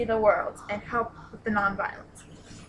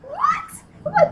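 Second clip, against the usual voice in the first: high, rising disbelieving whats, getting louder; shocked; 7 dB louder, much slower than usual. Retold or imitated. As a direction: squeal what in mock disbelief. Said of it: "It's like".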